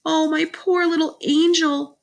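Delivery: high, gushing motherly wail; cried with pity. A narrator's reading. Mother pities her injured toddler, high cooing cry. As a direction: fuss over the child in a high coo. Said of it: "my mother cried".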